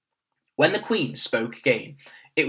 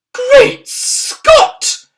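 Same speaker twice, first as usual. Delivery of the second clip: high, loud startled cry; a sudden alarmed outburst; cried out; 13 dB louder than usual. A narrator's reading. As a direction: exclaim in shock. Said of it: "cried the famous voice".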